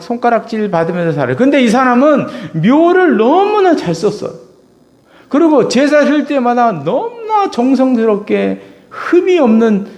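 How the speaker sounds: loud, high preaching cry; full-force preaching. Acted. Preacher crying out to his congregation, loud and high. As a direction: preach it out to the back row.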